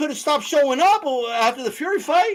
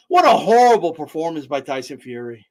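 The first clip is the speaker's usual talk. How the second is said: usual speaking voice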